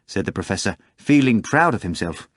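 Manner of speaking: ordinary delivery, nothing marked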